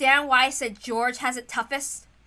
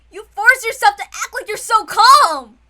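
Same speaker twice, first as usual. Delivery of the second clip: higher and louder, straining; emotional outburst; 6 semitones higher, 10 dB louder than usual. Acted. Battler tells George to stop hiding grief, loud outburst. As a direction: burst out at him. Confronting cousin's forced calm.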